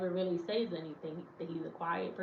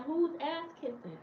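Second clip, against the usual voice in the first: much higher, affronted question; indignant retort; 10 semitones higher than usual. Retold or imitated. Woman snapping back at the commenter, pitched way up. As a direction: toss it back high and offended.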